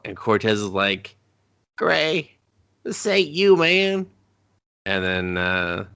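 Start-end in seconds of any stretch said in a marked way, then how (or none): 1.8-4.1 high-pitched acted appeal; mock pleading. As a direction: plead with him in a put-on high voice. Acted plea from a cop.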